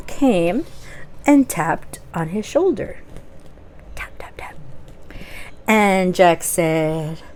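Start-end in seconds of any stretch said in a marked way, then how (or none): 4.0-4.6 breathy whispered tap sound; whispered sound effect. Acted. Narrator voicing a tap on Jack's shoulder, whispered.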